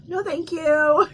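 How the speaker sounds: sassy slow decline; emphatic no thank you. Real, not acted. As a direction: draw out a firm refusal. Firm playful refusal.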